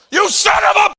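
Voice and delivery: very high, loud mock scream of fury; road rage yell. Dad cut off in traffic, shrieking mock rage. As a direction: scream it at the driver who cut you off. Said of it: "I got so mad, I go".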